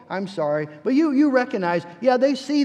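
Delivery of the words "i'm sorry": "I'm sorry." said clearly but without remorse; a tidy, correct "I'm sorry." with no feeling behind it; well-spoken apology. Shown as a demonstration. A child gives a polite apology with no remorse. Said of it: "a well-spoken".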